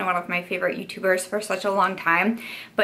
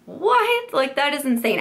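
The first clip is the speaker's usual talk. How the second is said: a sudden, much higher "What?", then still raised; stunned that they'd send it anyway; shocked disbelief; 7 semitones higher than usual. Retold or imitated. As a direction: squeal of total disbelief. Stunned by the surprise package.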